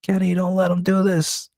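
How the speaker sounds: high, hammed-up begging; mock-scared pleading. Goose begging Kenny to stop the forced kiss, hammed-up high pleading. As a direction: fake terror, sell the panic. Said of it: "he was pretending he was scared".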